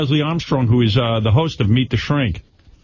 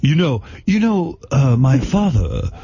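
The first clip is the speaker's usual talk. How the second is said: a light mock of a spaced-out storyteller; spacey alien-abductee impression; slower than usual. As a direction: drift through it like a tripped-out guest. Howard riffs on Riley's tale.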